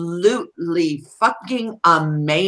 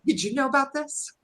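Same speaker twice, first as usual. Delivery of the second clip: high, soft, quick echo; imitated accusation; 8 semitones higher, 9 dB quieter, faster than usual. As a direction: imitate the question quickly and softly, pitched up. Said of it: "she goes".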